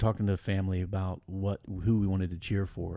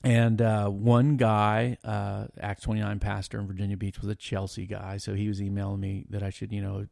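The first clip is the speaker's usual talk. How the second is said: ordinary talk, nothing marked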